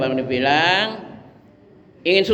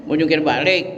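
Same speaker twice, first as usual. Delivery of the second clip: ordinary talk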